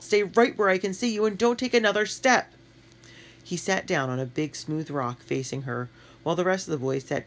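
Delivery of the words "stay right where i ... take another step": high, louder firm call. A narrator's reading. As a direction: keep calling firmly. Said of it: "Matt called".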